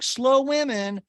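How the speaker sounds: slow, high snide jab; mean girl jab. Retold or imitated. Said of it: "Mean Girl comment".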